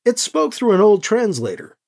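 plain reading voice

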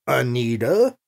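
gentle but stern. A narrator's reading. Gentry answers Anita's displeasure, gentle but stern. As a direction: gently push back with only the name. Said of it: "Gentry said back, gentle but stern".

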